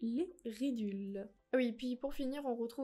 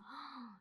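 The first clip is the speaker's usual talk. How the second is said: shocked gasp; 2 semitones higher, 12 dB quieter than usual. Real, not acted. Shocked by the quantity.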